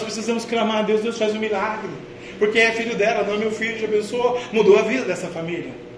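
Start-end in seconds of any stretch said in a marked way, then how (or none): none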